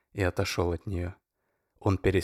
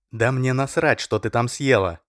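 loud angry bark; 5 semitones higher, 7 dB louder than usual. A narrator's reading. Oleg snaps at Masha in a loud angry bark. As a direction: snap it at her hard. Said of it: "рявкнул Олег".

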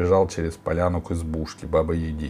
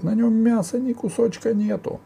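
high, frantic ogre excuse; крик людоеда. A narrator's reading. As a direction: keep protesting in a very high voice. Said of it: "закричал людоед". (кричит, оправдываясь, тонким голосом)

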